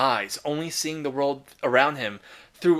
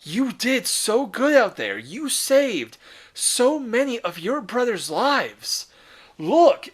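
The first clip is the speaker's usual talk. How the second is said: higher, slow, tender praise of a son; proud father; 7 semitones higher, 3 dB louder, slower than usual. Retold or imitated. Guilliman praising the wounded Marine, higher and tender. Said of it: "Guilliman approaches him and goes".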